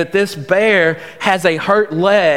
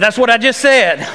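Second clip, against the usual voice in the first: higher, louder exasperated reply; mock-exasperated, pitched up and louder; exasperated retort; 6 semitones higher, 4 dB louder than usual. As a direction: snap back, fed up.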